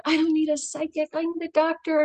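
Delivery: a light protest aimed at father; insisting. Retold or imitated. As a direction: plead it flatly to her dead dad. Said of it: "I think to my father".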